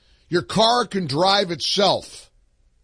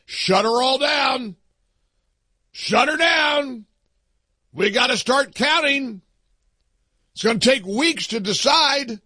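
hammed-up high-pitched announcement; mock official order; 6 semitones higher than usual. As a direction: call a halt in exaggerated alarm. Mock officials halting the count.

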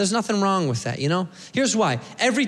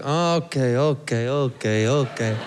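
low, measured repeated okays; unimpressed staff; 7 semitones lower, slower than usual. Acted. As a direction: humour the show-off in a low voice. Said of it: "the staff looks at him like".

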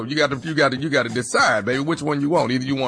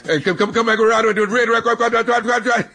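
high, fast, garbled Southern mumble; mumbling Southerner; 7 semitones higher than usual. Retold or imitated. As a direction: rattle it off like a tongue-tied country boy. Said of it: "That's how he sounds".

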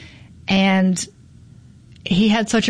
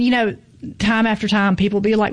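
ordinary delivery

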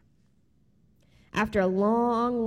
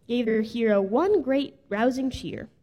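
usual speaking voice

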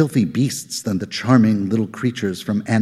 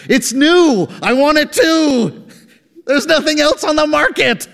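high, loud, worked-up rant; getting loud; 4 dB louder than usual. Acted. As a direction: let the outrage boil over. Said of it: "Rogler was getting loud".

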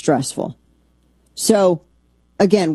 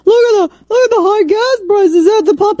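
high, shrill-free mock congresswoman whine, raised and louder; mocking Marjorie Taylor Greene; 4 dB louder than usual. Host mocking a congresswoman's gas price video in a high mock whine. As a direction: lampoon her video voice, pitched way up. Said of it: "She did this video".

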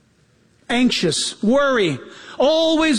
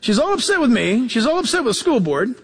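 normal talking voice

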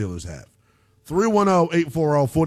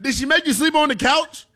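high-pitched, laughing question; amused teasing; 12 semitones higher than usual. Real, not acted. Host ribbing caller about his wife, amused and high. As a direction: tease him, voice pitched way up with amusement.

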